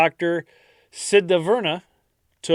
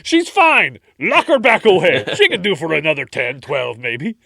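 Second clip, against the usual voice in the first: careless family voice; 2 semitones higher, 6 dB louder than usual. Pretend. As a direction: shrug it off loudly. Acted dismissive family voice.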